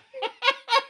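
laughing high after the bit; a burst of high laughter. Real, not acted.

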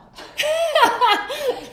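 high, loud burst of real laughter; big laugh. Real, not acted. Recalling the letter.

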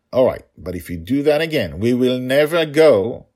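firm ultimatum, no give; stern warning. Acted. Father's final warning.

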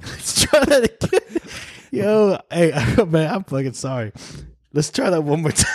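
high, giggling sorry; laughing apology. Real, not acted. Laughing retry.

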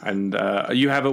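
ordinary talk

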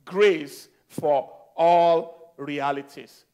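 "Grace for All Realities" drawn out, weight on ALL; slow, deliberate title. Real, not acted. Preacher repeating the message title to the congregation, slow and emphatic. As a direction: spell out each word slowly, stretching ALL.

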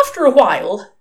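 plain reading voice

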